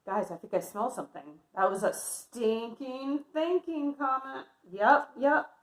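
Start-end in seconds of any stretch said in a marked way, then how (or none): none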